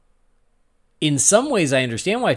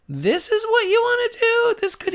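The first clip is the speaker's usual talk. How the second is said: high, put-on voice of disbelief; incredulous. To the show's writers, mocking their choice in high disbelief. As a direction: squeal the question in mock disbelief.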